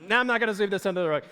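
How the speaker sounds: fast playful take-back; mock self-correction. Preacher correcting his own joke, fast and playful. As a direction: snap back quickly, half-joking.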